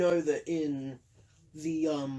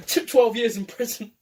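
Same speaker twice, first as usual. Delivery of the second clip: laughing; 2 semitones higher, 4 dB louder than usual. Real, not acted. Laughs mid-verdict.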